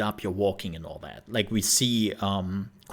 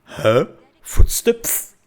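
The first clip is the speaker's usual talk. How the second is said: much higher and louder, lisped Footsteps; lisping guard; 8 semitones higher, 10 dB louder, much slower than usual. Retold or imitated. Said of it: "And then he goes".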